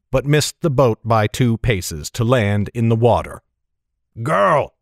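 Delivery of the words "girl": contained cry of command; captain crying out. A narrator's reading. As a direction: call sharply to a crew member. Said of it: "cried Brimlad".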